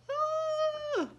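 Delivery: very high squeaky ooh; surprised ooh. A narrator's reading. Reacting to house falling.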